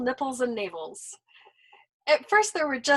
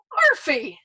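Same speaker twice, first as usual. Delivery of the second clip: shocked cry of the name, louder; aghast; 4 semitones higher, 9 dB louder than usual. A narrator's reading. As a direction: blurt the name in horror. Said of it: "Nately was aghast".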